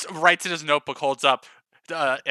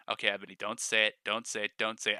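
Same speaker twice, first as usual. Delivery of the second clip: quick, softer self-talk; inner warning to herself; 7 semitones lower, 6 dB quieter, faster than usual. Acted. Ebony quietly warning herself, quick and softer. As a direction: hurriedly tell yourself not to say it. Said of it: "thinking to herself".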